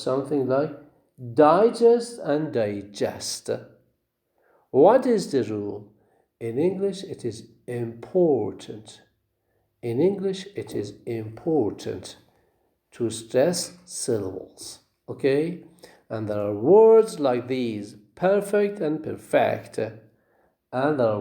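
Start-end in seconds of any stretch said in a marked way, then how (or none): 1.3-3.5 slow DI-gest and di-GEST; first digest stressed up front, second digest at the end; contrasting syllable stress. Shown as a demonstration. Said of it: "the stress is on the first syllable". 18.1-19.8 PER-fect, then per-FECT; first perfect stressed up front, second perfect at the end; contrasting syllable stress. Shown as a demonstration. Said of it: "the stress is on the first syllable".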